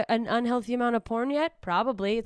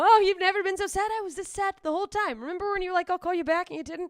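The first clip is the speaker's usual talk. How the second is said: much higher, mocking sing-song; sarcastic retort; 10 semitones higher, 4 dB louder, faster than usual. To newly depressed friends, high sarcastic retort. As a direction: needle the friend in a high mock voice. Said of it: "And I have to go".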